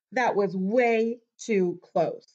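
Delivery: ordinary delivery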